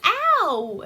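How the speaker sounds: loud, high mock "ow"; pained yelp. Aunt bitten by a turtle, loud acted yelp. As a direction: yelp as if suddenly nipped. Acts out aunt getting hurt.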